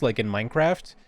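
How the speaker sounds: ordinary talk, nothing marked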